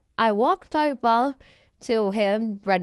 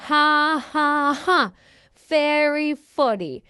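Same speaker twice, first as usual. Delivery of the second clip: slow, high, deadpan ha-ha; sarcastic fake laugh; 5 semitones higher, slower than usual. Bash answering a taunt, slow sarcastic fake laugh. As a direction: spell out each ha, unamused.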